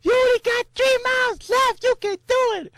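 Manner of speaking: high excited shouting from the crowd; crowd yelling. Retold or imitated. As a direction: cheer the runner toward the finish. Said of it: "people yelling".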